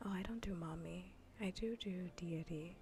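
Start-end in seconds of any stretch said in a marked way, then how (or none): none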